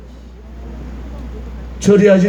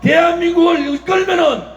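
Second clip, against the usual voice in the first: fiery rally speech; 7 semitones higher than usual. Real, not acted.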